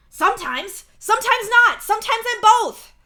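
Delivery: high, very loud exasperated bursts; shouting. A narrator's reading. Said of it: "he shouts".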